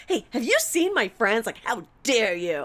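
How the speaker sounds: high, loud mock-outraged question; accusing. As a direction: demand it like they are guilty. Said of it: "I was probably maybe even being like".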